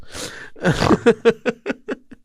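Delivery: long, high-pitched laugh; real laughter. Real, not acted. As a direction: crack up at a shared callback. Both amused by the callback.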